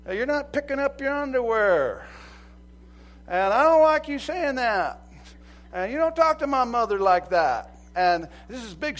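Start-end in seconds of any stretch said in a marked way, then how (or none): 0.0-7.8 high, loud scolding wife voice; nagging wife. Retold or imitated. Wife listing her husband's faults, scolding loudly. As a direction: scold him like a fed-up wife. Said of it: "your wife's gonna tell you".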